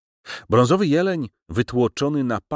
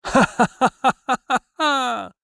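huge booming laugh, much higher and louder; roaring laughter; 5 dB louder than usual. A narrator's reading. Professor bursting out laughing at his own loss, booming roar. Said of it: "ryknął ogromnym, huczącym śmiechem".

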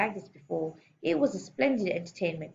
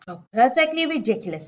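loud, higher scornful outburst; snorting contempt; 5 semitones higher, 7 dB louder than usual. A narrator's reading. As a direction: snort it out as a dismissive headmistress. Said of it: "snorted the Trunchbull".

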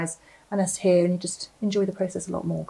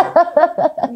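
burst of laughter; 10 semitones higher, 8 dB louder than usual. Real, not acted. Laughs at name mix-up.

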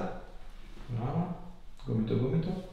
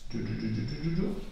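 soothing giù; 5 dB quieter than usual. Real, not acted. Therapist soothing patient into relaxing.

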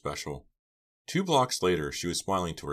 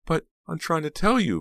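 high, loud, desperate insistence; frightened protest; 13 semitones higher, 7 dB louder than usual. A narrator's reading. Pam choking out words to attacker, panicked protest. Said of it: "choking out the words".

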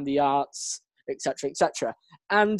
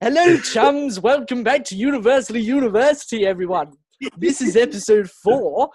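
upbeat, higher podcast welcome; cheery host welcome, second take; brighter intro; 4 semitones higher, 3 dB louder than usual. Shown as a demonstration. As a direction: redo the intro with more energy. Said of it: "was that better?".

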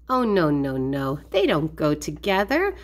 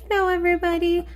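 higher-pitched puppet hello; rhinoceros greeting; 7 semitones higher, much faster than usual. Pretend. Toy rhinoceros says hello to everybody, higher puppet voice.

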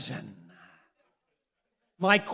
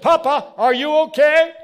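high, loud imitation of a worried daughter; alarmed daughter; 9 semitones higher, 12 dB louder than usual. Daughter turns to check on her father, alarmed. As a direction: call out to Papa in alarm.

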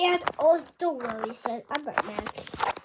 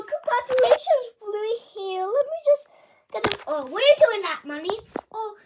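usual speaking voice

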